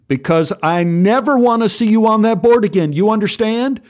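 stern lifeguard's loud reprimand; angry scolding. Acted. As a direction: lay down the law to a kid.

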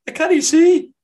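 high, loud put-on Grange Hill voice; Grange Hill impression. Shown as a demonstration. Podcaster trying out his Grange Hill impression, high and loud. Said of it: "I'll do my Grange Hill impression".

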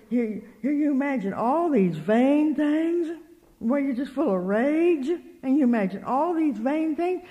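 slow, high-pitched scolding; retold rebuke. Speaker correcting her worried friend, slow retold scolding.

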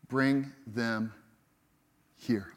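soft, low and inviting; gentle invitation. Retold or imitated. Jesus gently asking disciples for their food, softly. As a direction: invite them in tenderly.

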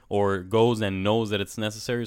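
ordinary delivery

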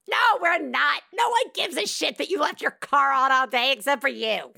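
high-pitched comic shouting; emphatic mock outburst. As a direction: burst out to shout the worry down. Shouts down the imagined assumption.

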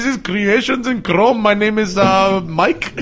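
put-on high, loud tech support voice in Indian accent. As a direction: play a call-center rep in Indian accent. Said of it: "Can you do an Indian accent?".

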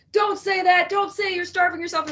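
high-pitched mimicry of scolding critics; imitated chorus of objectors; mock scolding crowd. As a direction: pitch it up like people telling you off. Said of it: "Bunch of people".

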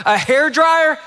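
high, disbelieving question; incredulous. Retold or imitated. Husband balks at packed hair dryer.

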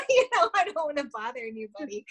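high-pitched, quick, through a laugh; laughing self-deprecation. Real, not acted. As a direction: laugh at yourself while explaining. Laughs at her own reluctance.

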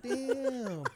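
high, loud laughing "damn"; laughing mock shock. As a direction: burst out in mock dismay. Retelling being caught out.

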